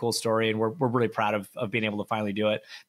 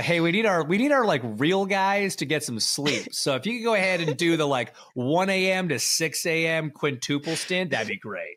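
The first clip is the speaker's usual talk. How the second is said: higher, sugary sarcastic boss asking a favour; mock team boss; 6 semitones higher than usual. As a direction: play a team boss sweetly dumping the night stint on you. Mocking the team's stint request.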